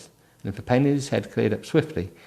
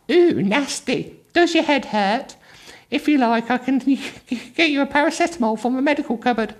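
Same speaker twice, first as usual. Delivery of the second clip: effeminate attendant. Acted. Attendant offers Trevor paracetamol, high camp cooing. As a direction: coo over the injury in a high camp voice. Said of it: "once again in an effeminate voice".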